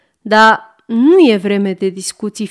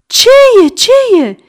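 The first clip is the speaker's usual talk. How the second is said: startled, much higher and louder; alarmed ladies; 13 semitones higher, 9 dB louder, faster than usual. A narrator's reading. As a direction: jump up in alarm. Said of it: "sar cocoanele". (cucoanele sar speriate, mult mai sus și mai tare)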